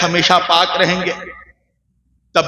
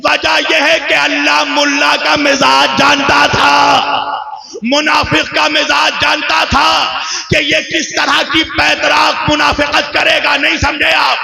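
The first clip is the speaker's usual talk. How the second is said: high, ringing oratorical argument; full-force preaching; 7 semitones higher than usual. Real, not acted. Preacher argues the verse's double wording to the congregation, full-force preaching. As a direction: build the argument in sustained proclamations.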